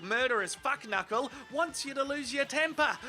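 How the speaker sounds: ordinary delivery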